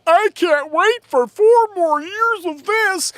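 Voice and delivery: high, loud, drawn-out mock cheer; hammed-up high-pitched eagerness; mocking crony goons. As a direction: gush slowly in a high parody voice. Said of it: "president and all of his little g-, crony goons saying".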